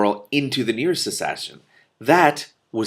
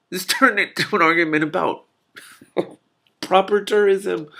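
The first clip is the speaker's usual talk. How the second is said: incredulous outburst; 9 semitones higher than usual. Real, not acted. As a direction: blurt it out in amused disbelief. Laughing disbelief at the text.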